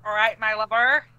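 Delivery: imitating a sister-in-law's mock Bristolian; mock Bristolian accent. Retelling sister-in-law mocking her accent, light Bristolian. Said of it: "taking the piss out of her own accent".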